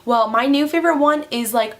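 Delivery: normal talking voice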